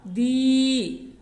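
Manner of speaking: दी held long, its ई drawn out to show a long vowel; long vowel stretched. Shown as a demonstration. Teacher showing students how long दी is held. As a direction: stretch दी slowly so the long ई is clear. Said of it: "थोड़ी देर तक उच्चारण होता है".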